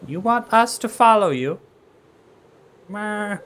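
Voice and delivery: high, loud bleating goat. Acted. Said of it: "that's the assigned goat voice".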